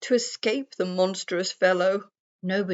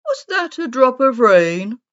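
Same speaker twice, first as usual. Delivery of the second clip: loud, higher puzzled bear voice; grumpy bear; 6 semitones higher, 10 dB louder than usual. A narrator's reading. Bear pelted with nuts asks aloud, loudly puzzled. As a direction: boom out the bear's question.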